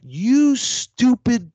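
high, loud, slow furious jab; angry slow insult; angry outburst. Retold or imitated. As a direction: snap an insult in disbelief. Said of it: "And I went".

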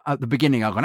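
ordinary talk, nothing marked

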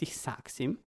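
usual speaking voice

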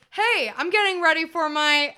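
imitated nerdy vlogger. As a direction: start it like an upbeat video intro. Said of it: "where he just goes".

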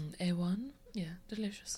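hushed playful whisper. Pretend. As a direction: whisper it back, savoring. Joining the whispered joke.

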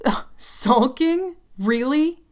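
high, slow, mocking sneer; scornful disbelief. Tim sneering at his girlfriend's accusation. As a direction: drag it out with contempt.

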